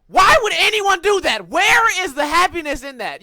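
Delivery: loud, high demanding why; indignant outburst. Real, not acted. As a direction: rant at the injustice. Indignant rant about slapping.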